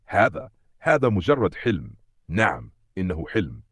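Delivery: plain reading voice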